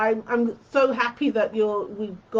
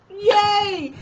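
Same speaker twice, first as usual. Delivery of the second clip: high bright yay; cheering; 10 semitones higher, 4 dB louder than usual. Real, not acted. Cheering a comment.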